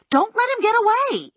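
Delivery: very high, urgent call; urgent yell. A narrator's reading. Bounty hunter to partner as the man escapes, urgent high-pitched yell. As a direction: cry out high to stop an escape. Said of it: "I yelled, rushing at Lula".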